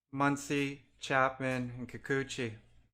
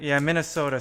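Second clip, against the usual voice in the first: excited; 9 dB louder, faster than usual. Real, not acted. Big pull from the pack.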